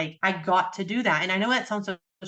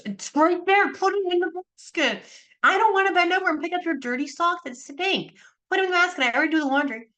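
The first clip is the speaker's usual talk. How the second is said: exasperated nagging; 10 semitones higher than usual. Retold or imitated. Retelling how she nagged ex-husband, exasperated scolding. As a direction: snap at him to use the basket.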